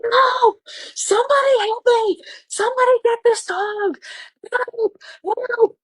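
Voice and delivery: high-pitched scream for help; full-out mock terrified shriek; panicked screaming. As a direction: scream like you're being attacked, reenacting it. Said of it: "I start screaming like a bitch".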